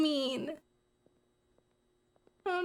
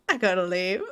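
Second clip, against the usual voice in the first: laughing; 5 semitones lower than usual. Real, not acted. Laughing while scared.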